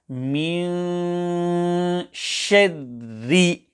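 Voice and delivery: مِنْ شَدِّ recited with long nasal hum and thin r sounds; empty mouth ra. Shown as a demonstration. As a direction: hold the nasal in مِنْ, then keep شَدِّ light and thin. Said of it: "must be pronounced empty mouth".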